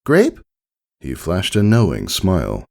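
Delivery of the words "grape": high, eager little filly question; a kid hoping for her favourite; hopeful filly. A narrator's reading. Filly asking her dad about the juice, high and hopeful.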